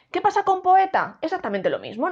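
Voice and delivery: usual speaking voice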